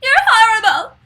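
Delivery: high, loud mock yell at grandma; imitating a yell. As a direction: scream it like a bratty kid in a movie. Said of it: "she's yelling at her saying".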